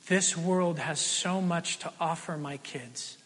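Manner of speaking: ordinary talk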